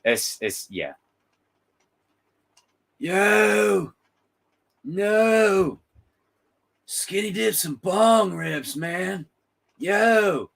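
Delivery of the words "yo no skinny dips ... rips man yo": higher, loud stoner surfer; high laid-back surfer dude. Retold or imitated. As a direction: whine like a chill surfer whose board broke. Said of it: "Great surfer impression".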